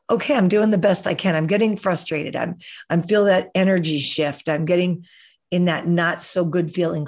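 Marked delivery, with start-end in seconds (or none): none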